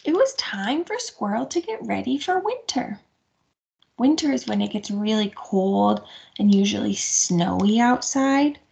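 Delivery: gentle picture-book reading; reading to children. A narrator's reading. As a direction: read it to little ones. Story narration to children.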